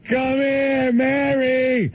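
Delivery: very high-pitched, drawn-out calling; Bill. Retold or imitated. Bill chasing an old lady down the street, high calling. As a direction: caricature a simple man calling after someone. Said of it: "And he's going".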